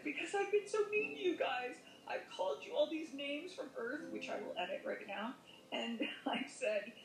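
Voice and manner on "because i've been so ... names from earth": high, tearful apology, voice pitched up; re-live the tearful confession to the archangel; a touch of retold sobbing, much higher; retold crying plea. [name], why are you crying?.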